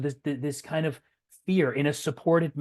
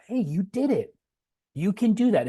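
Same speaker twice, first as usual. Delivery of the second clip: put-on higher, louder cheering for the child; proud parent praise; 8 semitones higher, 4 dB louder than usual. Parent praising child after ordering.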